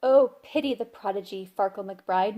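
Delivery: usual speaking voice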